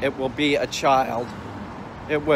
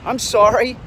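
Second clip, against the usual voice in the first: mock clueless owner; 6 semitones higher than usual. Ranting about an owner whose dog bites, mock high innocent excuse. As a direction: play the oblivious owner making innocent excuses. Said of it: "And what will he say?".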